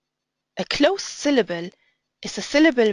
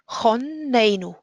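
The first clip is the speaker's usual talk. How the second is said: Chonenu with a stretched, stressed middle "ne". Shown as a demonstration. Teacher showing where the stress falls, on the ne of Chonenu. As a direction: lean on the ay of "ne" in "Chonenu". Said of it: "The stress is on the A".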